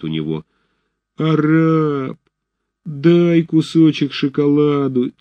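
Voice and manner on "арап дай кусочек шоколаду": high, slow begging whine; wheedling tone. A narrator's reading. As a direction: drag it out, sweetly pleading. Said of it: "тянет Федченко умильным тоном".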